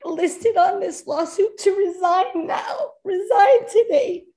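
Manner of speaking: holding back tears as she demands resignations; emotional, near tears. Real, not acted. Mother pleading with the board, close to crying. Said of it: "I'm sorry I'm emotional".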